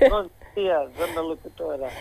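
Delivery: just woke up voice; groggy, quiet morning greeting; sleepy good morning. Real, not acted. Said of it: "tá mesmo com voz de quem acabou de acordar".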